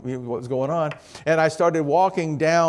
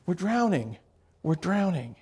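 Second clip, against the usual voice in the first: soft mock panic; 3 semitones higher, 6 dB quieter than usual. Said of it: "his perception was".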